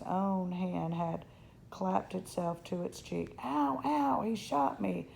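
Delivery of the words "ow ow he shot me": high, acted yelp of pain; pained scream. Boy shot in the cheek, crying out in pain. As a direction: cry out in hurt outrage, short of a full scream. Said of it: "Patrick screamed".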